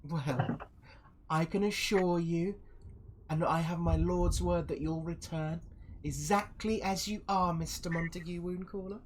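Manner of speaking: high, formal put-on voice; assuring villainous envoy. Envoy gives her lord's word to Montague, high and smooth. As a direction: coax the wary guest in high, courtly tones. Villain reassures wary guest.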